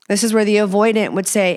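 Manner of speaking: usual speaking voice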